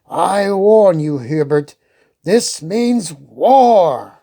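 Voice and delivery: slow, deliberate warning; grave warning. Acted. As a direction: lay each word down heavily.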